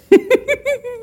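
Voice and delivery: high trilling bird laugh; crane laugh. Acted. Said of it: "she laughed".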